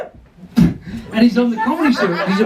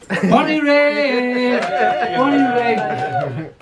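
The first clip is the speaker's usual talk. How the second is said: long, stretched mock cheer; slow, drawn-out call to the crowd; drawn-out town cheer; 2 semitones higher, much slower than usual. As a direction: stretch the name out like a hometown chant. Hailing audience members by their town.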